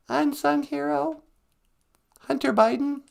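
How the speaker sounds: very high squeaky question. Acted. The sidekick squeaks a name at the host. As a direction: chirp it like a puppet.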